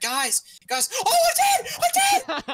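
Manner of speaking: high squealing mock panic; building to a shrieked imitation of panic; imitated panicking teammate. Friend mocking a clueless player's death, panicked shriek. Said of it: "He's like".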